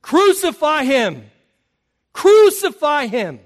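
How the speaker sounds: loud, slow, chanting crowd cry; a slow, hammered-out mob chant, each crucify punched; mob yell. Retold or imitated. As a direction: shout it like an angry mob demanding death.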